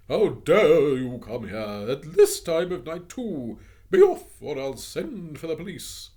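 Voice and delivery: loud, high, slow furious order; angry scolding. Acted. Missus Biddle ordering the children out, furious. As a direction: chase them out, outraged.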